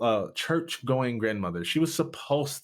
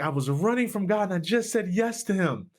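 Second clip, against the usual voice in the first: excited; 9 semitones higher than usual. Retold or imitated. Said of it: "I was like".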